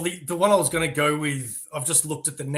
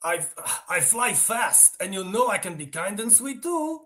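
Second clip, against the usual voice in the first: put-on slow, higher-pitched Russian-accented boast; 5 semitones higher than usual. The guy pitching himself to the girl, Russian accent. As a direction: plead your case in a put-on Russian accent. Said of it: "if I have to do a Russian accent".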